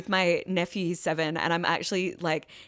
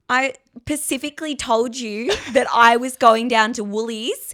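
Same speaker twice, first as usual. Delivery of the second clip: loud, higher, broad Aussie rant; hammed-up Australian complainer; 6 semitones higher, 8 dB louder than usual. Showing how Australians say pacifically, broad Aussie telling-off. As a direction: scold in an exaggerated Australian accent. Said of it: "Australians do that too".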